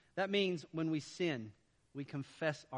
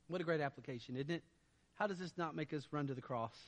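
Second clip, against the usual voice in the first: ordinary talk, nothing marked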